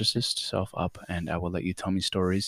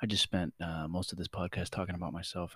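hushed confession; quiet; 2 semitones lower, 11 dB quieter than usual. Real, not acted.

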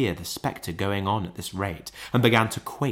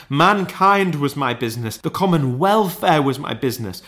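high, impassioned declamation; crying ghost; 6 semitones higher, 4 dB louder than usual. A narrator's reading. Ghost rebuking Scrooge over business, high and impassioned. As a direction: hammer out each phrase in anguish. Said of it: "cried the ghost".

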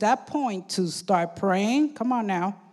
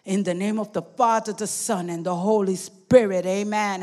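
a hushed, earnest closing prayer; 4 semitones lower than usual. Acted. Pastor praying to God before the sermon, hushed and earnest.

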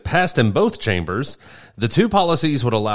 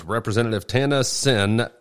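ordinary talk, nothing marked

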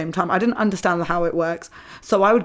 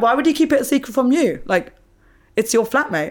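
higher, louder incredulous question; 6 semitones higher, 3 dB louder than usual. Retold or imitated. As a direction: ask it as if it's obvious. Retells her own puzzled reply.

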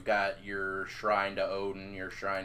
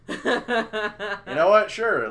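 high, loud words through real laughter; slow it down, laughing hard as you give in; 12 semitones higher, 10 dB louder, slower than usual. Real, not acted. Laughing at request to roll.

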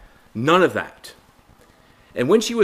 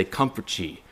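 soft, gentle consoling read; softly comforting scripture; tender comfort; 5 semitones lower, 9 dB quieter than usual. Shown as a demonstration. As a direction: hush it like comforting a grieving friend. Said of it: "Elijah didn't go to Sinai to say".

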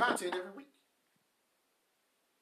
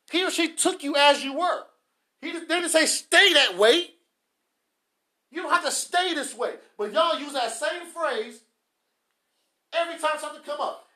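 impassioned rebuke; 6 semitones higher, 11 dB louder than usual. Real, not acted. Preacher rebuking spouses who stay the same, impassioned shouting. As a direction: push the voice up and hammer the point.

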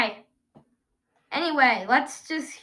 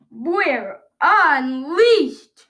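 slow, drawn-out announcer title; 5 dB louder, much slower than usual. Acted. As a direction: stretch out the title like a host.

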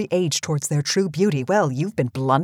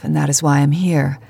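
ordinary talk

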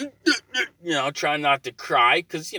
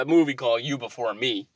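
usual speaking voice

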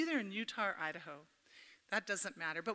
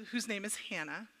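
ordinary talk

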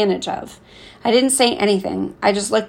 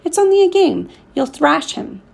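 pitched up high, eager cheering on; encouraging; 9 semitones higher than usual. A narrator's reading. Murat urging the boy on, excited and high. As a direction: egg him on with bright excitement. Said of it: "Murat encouraged".

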